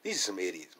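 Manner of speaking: ordinary talk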